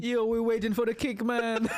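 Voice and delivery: lightly put-on Jamaican accent. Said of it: "in Jamaican Inception".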